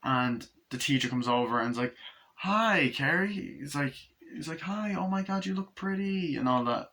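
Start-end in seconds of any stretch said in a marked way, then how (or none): 2.3-3.6 high, sing-out hello; gushing greeting. Retold or imitated. Teacher spots Carrie at prom, gushing greeting. 4.6-6.3 gushing compliment. Retold or imitated. Teacher admires Carrie at prom, gushing.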